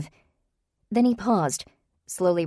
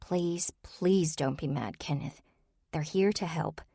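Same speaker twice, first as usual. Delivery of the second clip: much softer, pleading and slow; low voice; 5 dB quieter, slower than usual. Acted. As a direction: keep it down so only he hears. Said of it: "keeping her voice low".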